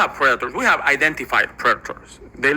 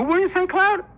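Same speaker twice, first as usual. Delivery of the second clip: much higher, slowed-down astonished question; amazed question. Retold or imitated. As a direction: let it leap up in disbelief. Guard amazed at shared hometown.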